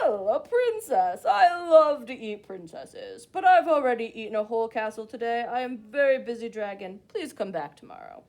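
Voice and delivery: a big bored dragon booming through the door. Acted. Dragon booming at the princess to go away.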